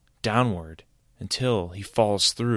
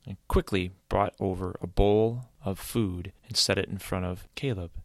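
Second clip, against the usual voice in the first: usual speaking voice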